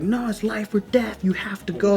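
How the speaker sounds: hushed, pressing whisper; urgent whisper. Retold or imitated. Friends urging in whispers.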